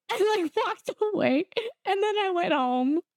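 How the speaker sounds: high voice breaking into laughter; laughing. Real, not acted. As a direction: laugh through the end of the story. Laughing at the end of story.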